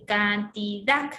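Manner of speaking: cantidad pulled apart slowly, rising on DAD; slow stress on final syllable. Shown as a demonstration. Teacher re-showing the last syllable, slow cantidad with stressed DAD. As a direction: sound out can-ti-DAD, weight at the end. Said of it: "esta es la última sílaba, lleva ahí la mayor fuerza de voz".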